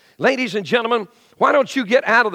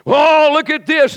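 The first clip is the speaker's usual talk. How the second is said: mock amazement; 8 semitones higher, 6 dB louder, slower than usual. Israelites marvel in retold story.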